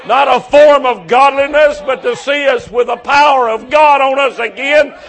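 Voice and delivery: loud, high, ringing sermon climax; full-force preaching. Acted. Preacher urging the congregation to be salt and light, full-force preaching. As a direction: drive it home to the whole tent.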